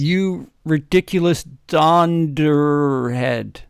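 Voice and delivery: slow, loud sneering insult; villain yell. Acted. Co-host voicing the villain insulting the students, slow and loud. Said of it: "Snoop yielded".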